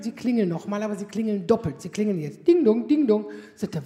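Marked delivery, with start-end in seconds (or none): 2.5-3.3 sung ding dong like a doorbell chime; doorbell imitation. Comic telling the courier how to ring, doorbell chime.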